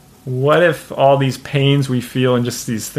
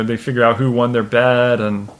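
normal talking voice

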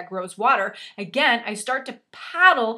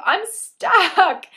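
high, loud retold holler; yelling back; 9 semitones higher, 8 dB louder than usual. Wife stuck on a branch answers her husband, yelling back. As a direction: cry out for help across a distance.

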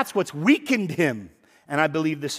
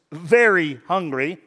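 "very hungry" slowed and leaned on hard; "very hungry" stretched and stressed; emphasis on very hungry; 5 dB louder, slower than usual. Shown as a demonstration. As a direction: drag out "very hungry" for weight. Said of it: "all emphasis".

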